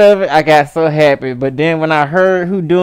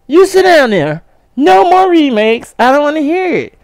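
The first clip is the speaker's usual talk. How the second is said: high, loud scolding rant; fed-up rant; 11 semitones higher, 3 dB louder than usual. Real, not acted. Ranting against remakes.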